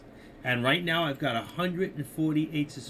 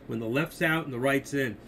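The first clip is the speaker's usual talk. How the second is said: ordinary talk, nothing marked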